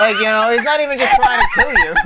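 high, breaking into laughter; laughing hard. Real, not acted. Host explaining a joke to co-host, real laughter throughout. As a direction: try to talk while cracking up.

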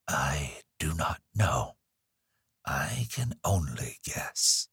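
slow whispery old woman, barely voiced; cracked little voice. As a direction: breathe it out like a frail hundred-year-old nurse. Ancient nurse answering Evelyn.

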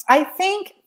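choked-up rise, fighting tears; emotional catch. Real, not acted. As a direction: voice catching high. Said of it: "okay, let me just breathe here".

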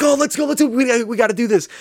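high-pitched, eager egging on; mock excited rush to get going; hyped-up urging. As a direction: imitate giddy pranksters psyching each other up. Acted hyped-up prank plotting.

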